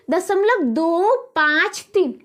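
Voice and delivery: slow, each digit set apart: दो, पाँच, तीन. Shown as a demonstration. Teacher showing how decimal digits are read, दो पाँच तीन slowly one by one. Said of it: "एक एक करके पढ़ेंगे".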